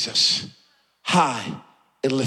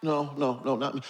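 softer, lower humble refusal; meek self-effacing believer; 5 semitones lower, 7 dB quieter than usual. Acted. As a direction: shrink away from the blessing, quietly. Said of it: "Some of us are going through life".

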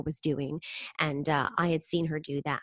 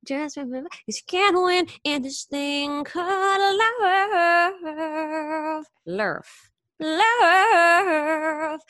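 slow, much higher sung line with a rolled "lurf"; 10 semitones higher, 6 dB louder, much slower than usual. Retold or imitated. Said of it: "she says".

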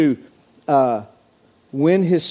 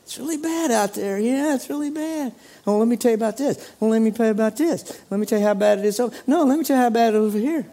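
mock the whiners, pitched up and moaning; whiny complaining Christians; 6 semitones higher, faster than usual. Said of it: "I hear a lot of whining".

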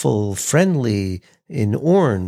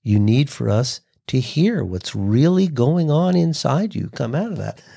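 usual speaking voice

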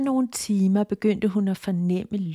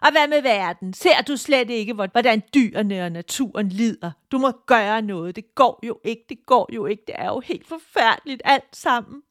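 screaming demon; 3 semitones higher, 5 dB louder than usual. Acted. The demon screams at Tina about the world's suffering. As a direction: shriek the worry at her, nagging hard. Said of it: "Dæmonen skreg igen".

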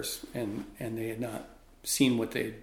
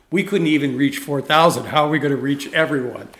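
louder, protesting disbelief; group in uproar; 2 semitones higher, 10 dB louder than usual. Retold or imitated. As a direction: throw up your hands, retold loudly. Group goes nuts in disbelief.

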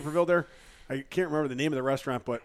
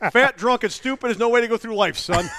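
louder, higher mock fatherly lecture; stern father's advice; 5 semitones higher, 4 dB louder than usual. Father lecturing his son on life, loudly. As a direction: bark it like a gruff old dad. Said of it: "Pappy said that a time or ten".

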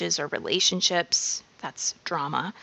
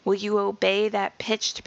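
ordinary talk

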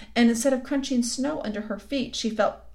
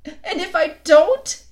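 high, loud, slow wail of a weeping fawn; crying; 9 semitones higher, 10 dB louder, slower than usual. A narrator's reading. Tumnus starts crying as he answers Lucy, high loud wail. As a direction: let it break out as he starts to cry. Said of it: "beginning to cry again".

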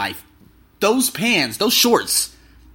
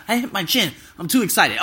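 ordinary delivery

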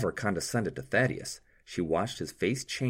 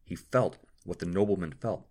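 plain reading voice

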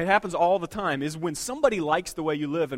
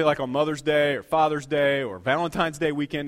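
ordinary delivery

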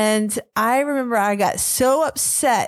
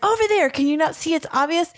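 much higher, exasperated "Over there"; impatient "Over there"; 7 semitones higher, faster than usual. Retold or imitated. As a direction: act it out as if it's obvious, in disbelief. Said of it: "And I was like".

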